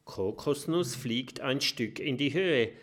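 plain reading voice

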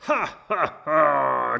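high, loud guffaws; rowdy jeering laughter. Acted. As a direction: bellow a crowd laugh. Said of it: "gröhlen die Piraten".

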